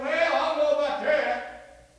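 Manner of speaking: loud, high, drawn-out objection; doubting listener's objection. Acted. Preacher voicing a doubting churchgoer, loud and drawn out. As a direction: push the doubter's reply out slowly and loudly. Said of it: "You said".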